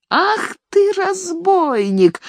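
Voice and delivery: put-on high, drawn-out old crone yell; old woman yelling. A narrator's reading. Nanny yelling after the fleeing servant, high and slow. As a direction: shriek after him like a scandalized nanny. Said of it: "кричала ему вслед Фелицата Никаноровна".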